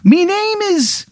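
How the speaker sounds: a leprechaun's terrible Irish lilt; bad Irish accent. Leprechaun ghost greeting Aaron, in a bad Irish accent. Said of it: "the worst Irish accent you've ever heard".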